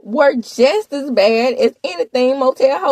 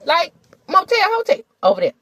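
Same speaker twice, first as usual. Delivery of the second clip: normal talking voice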